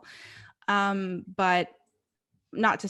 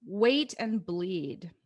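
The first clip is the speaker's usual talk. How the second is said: very slow, spaced-out quiz clue; drawn-out quiz-host reading; quiz title read out; much slower than usual. Shown as a demonstration. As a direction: stretch each word like a game-show question. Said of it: "say some of them out loud".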